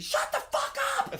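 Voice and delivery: very high, loud yell; shout. Retold or imitated. Director blowing up at chatty crew, shouting. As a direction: blow up at the noisy crew. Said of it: "I goes".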